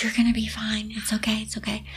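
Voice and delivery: fading to a soft hush; soothing hush. Retold or imitated. Dwayne soothes crying Grace, fading soft whisper.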